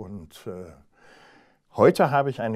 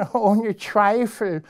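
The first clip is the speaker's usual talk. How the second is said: high, slow scornful laugh and reply; mocking hare; 10 semitones higher, 4 dB louder, slower than usual. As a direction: laugh first, then sneer it out slowly. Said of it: "spottete der Hase".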